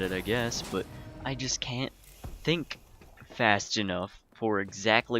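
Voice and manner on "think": ordinary talk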